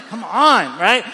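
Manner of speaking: ordinary delivery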